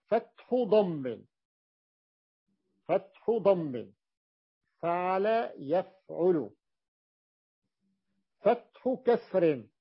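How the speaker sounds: slow, deliberate reading of the pattern; slow emphasis. Shown as a demonstration. Teacher to students, slowly spelling out vowel patterns. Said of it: "لاحظوا".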